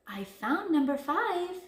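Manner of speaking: high, lilting good news; sweetly. A narrator's reading. Big Bad Wolf returning number five, sweetly. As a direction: announce it sweetly, loud enough for indoors. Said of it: "he said sweetly".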